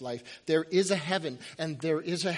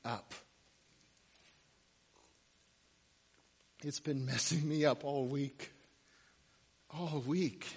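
hushed; 5 semitones lower, 8 dB quieter than usual. Real, not acted. Solemn turn in sermon.

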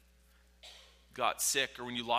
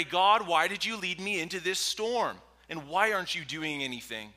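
raised, higher, accusing plea to God; indignant prayer; 6 semitones higher, 8 dB louder than usual. Acted. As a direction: cry out at God in complaint. Praying complaint to God in storm.

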